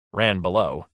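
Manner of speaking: usual speaking voice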